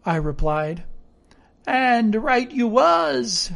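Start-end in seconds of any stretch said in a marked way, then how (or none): 1.7-3.5 higher, slow cry of agreement; castaway's pleased exclamation. A narrator's reading. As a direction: agree eagerly, drawn out and pitched up. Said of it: "he cried".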